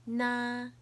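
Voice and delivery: "Na" held high and level; first tone. Shown as a demonstration. Teacher showing the four tones, "Na" high and level. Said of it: "I'll say the four tones".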